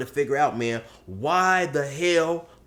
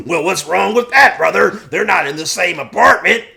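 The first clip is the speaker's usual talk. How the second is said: loud, higher incredulous bro; Polar Bear; 6 semitones higher, 10 dB louder than usual. Acted. Buddy dismissing Josh's worry, loud incredulous bro. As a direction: argue back loudly, buddy to buddy. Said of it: "Polar Bear says".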